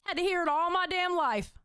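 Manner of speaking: ordinary delivery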